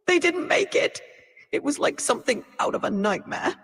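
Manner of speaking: high, halting distressed voice; distraught survivor account. Pretend. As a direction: recount a disaster in anguish, pitch climbing. AI acting out a disaster account.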